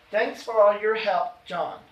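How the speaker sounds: slow phrase, breaking before "John"; slight comma pause. Shown as a demonstration. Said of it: "You hear that slight pause?".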